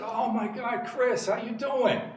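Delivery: thrilled recognition, acted out in a retelling; delighted greeting. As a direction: greet a long-lost student with rising excitement. Retells greeting a former student.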